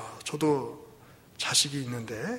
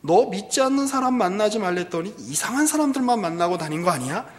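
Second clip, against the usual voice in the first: raised, louder voice of a scolding father; pressing interrogation; 6 semitones higher, 7 dB louder than usual. Acted. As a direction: press the daughter for answers, pitched up. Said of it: "다시 또 다그칩니다". (딸을 다그치는 아버지처럼)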